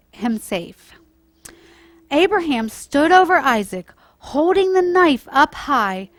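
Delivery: put-on storytime voice for children, lively and clear; gentle storyteller reading to kids; story narration. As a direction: read it like a bedtime Bible story.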